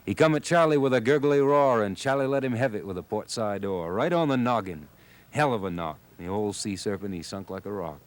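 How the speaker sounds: ordinary delivery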